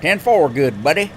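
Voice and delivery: playful Southern radio lingo; Southern accent. Acted. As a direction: put on a Southern trucker voice. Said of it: "everybody has a Southern accent on the radio".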